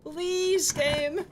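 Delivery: high, stretched-out begging; desperate drawn-out plea; pleading. Real, not acted. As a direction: beg the game, long and high. Begging the game.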